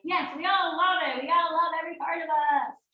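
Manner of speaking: high, loud sing-song cheer; mock enthusiasm. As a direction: gush it like an over-eager crowd. Acting out the wished-for eager yes.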